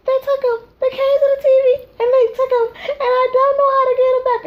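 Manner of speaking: high, loud frantic wail; hammed-up hysterical mom; panicked mother. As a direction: plead in a shrill panic. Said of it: "And I'm like".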